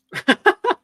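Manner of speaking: high, loud burst of laughter; short, high-pitched real cackle of amusement; amused laugh. Real, not acted. Cracking up at their own scene, loud high laugh. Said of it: "that's what I was laughing at".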